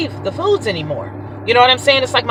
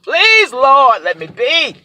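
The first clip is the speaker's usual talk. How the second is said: pleading prayer; slower than usual. Retold or imitated. Re-enacts begging the Lord for ketosis.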